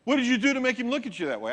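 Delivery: high, loud, fast accusing voice; jealous accusation. Retold or imitated. Jealous young man accusing his girlfriend, high fast accusation. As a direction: snap at her with suspicion. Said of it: "he turns and goes".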